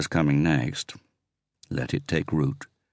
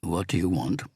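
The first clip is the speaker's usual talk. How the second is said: wobbly croaky voice; 3 semitones higher than usual. A narrator's reading. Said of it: "heard a wobbly, croaky voice".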